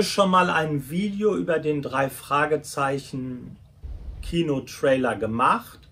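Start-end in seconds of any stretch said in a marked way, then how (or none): none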